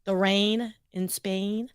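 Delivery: rain and Spain slow, mouth drawn back; mouth pulled back. Shown as a demonstration. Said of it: "pulling your mouth back to speak it".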